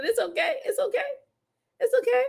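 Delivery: laughing it's okay. Real, not acted. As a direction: tease her gently, laughing. Teasing reassurance to viewer.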